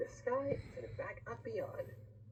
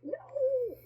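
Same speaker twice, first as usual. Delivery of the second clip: very high, loud drawn-out wail; long storybook shriek; witch crying out; 7 dB louder than usual. A narrator's reading. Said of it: "cried the witch".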